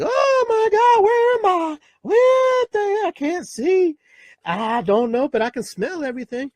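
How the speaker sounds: very high, loud, slow crying wail; wailing newborn. Retold or imitated. Newborn crying out at the world, very high and loud. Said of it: "you're just like".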